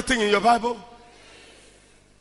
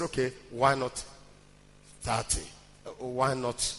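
very low, soft, halting bargaining; quiet pleading; 10 semitones lower, 9 dB quieter than usual. Retold or imitated. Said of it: "he kept pleading".

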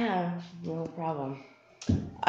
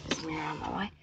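hushed whisper while shoving mirror; 3 semitones lower, 12 dB quieter, much faster than usual. Real, not acted. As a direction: whisper it under your breath.

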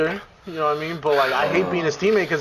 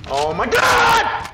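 drawn-out scream of alarm; a real, long, loud yell; startled scream; 4 dB louder, much slower than usual. Real, not acted. Gamer crying out in mid-match, screaming. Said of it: "why do you scream".

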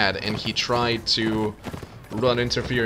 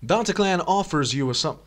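ordinary delivery

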